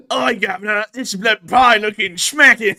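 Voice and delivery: loud, high mock British voice; drawn-out, hammed-up British accent. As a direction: belt out a silly British impression. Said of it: "The British come along saying".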